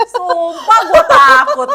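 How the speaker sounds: very slow, high, loud words through laughter; slow laughing. Real, not acted. Repeating the confession to a friend, slow and laughing. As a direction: drag it out while laughing.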